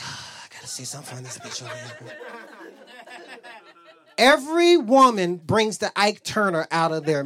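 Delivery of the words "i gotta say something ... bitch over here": low, quiet thinking to himself; muted inner grumble. Retold or imitated. A man thinking about his woman, low quiet grumble. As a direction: grumble it low under the breath.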